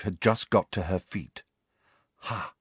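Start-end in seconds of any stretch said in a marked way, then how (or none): 2.2-2.5 softly. A narrator's reading. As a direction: let it out under your voice. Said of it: "he said softly".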